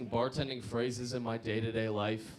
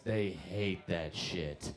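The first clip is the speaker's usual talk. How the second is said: usual speaking voice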